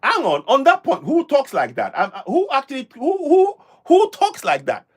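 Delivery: baffled, insistent outburst with pitch jumps; real incredulity. Real, not acted. Host reacting to a played clip, incredulous.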